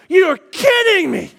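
drawn-out, loud, high comic exasperation; exasperated disbelief. Retold or imitated. Driver stuck behind two slow cars, exasperated disbelief. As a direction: stretch it out in mock disbelief. Acted exasperation while driving.

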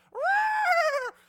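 loud, high animal shriek; colt's roar. Acted. Colt freaking out as Jesus sits, loud high roar. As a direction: scream like a bucking colt. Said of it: "the colt's like".

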